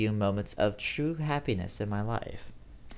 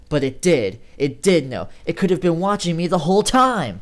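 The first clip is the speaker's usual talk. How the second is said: louder and higher terrified build; rising panic; 6 semitones higher, 9 dB louder than usual. A narrator's reading. Narrator realizing the thing saw everything, rising panic.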